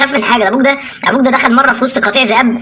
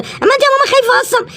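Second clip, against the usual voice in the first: put-on high, small child voice, frightened; a tiny cub whimpering to mom; little bear cub asks mom, high and scared. Cub questions frightened mother.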